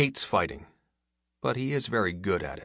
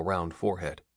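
ordinary delivery

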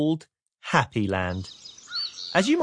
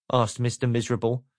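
ordinary delivery

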